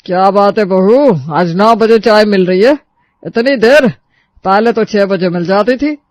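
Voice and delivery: father-in-law reproaching bride for late tea; stern father-in-law. Acted. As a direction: reproach her with cold sarcasm. Father-in-law Kailash angry.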